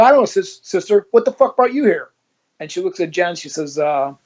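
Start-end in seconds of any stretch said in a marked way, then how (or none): 0.0-2.1 higher, louder mock-innocent jab; cheeky retort. To the nun who asked why he came, a brash mocking comeback. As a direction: toss it back at her with a cocky lilt.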